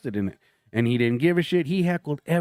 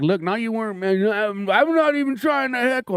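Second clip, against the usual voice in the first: mimicking the heckler; 7 semitones higher than usual. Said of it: "And I'm like".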